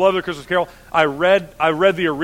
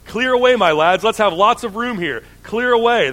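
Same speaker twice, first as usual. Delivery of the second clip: calling out; 6 semitones higher, 5 dB louder than usual. A narrator's reading. Fezziwig calling to his lads.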